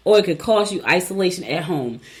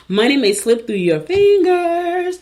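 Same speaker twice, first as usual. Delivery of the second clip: high, slow, stretched warning; dramatic warning; 10 semitones higher, 4 dB louder, slower than usual. Acted. Host warning Gemini listeners in a high slow dramatic voice. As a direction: draw it out high like a spooky omen.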